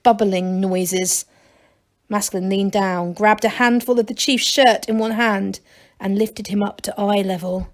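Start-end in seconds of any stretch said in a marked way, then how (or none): none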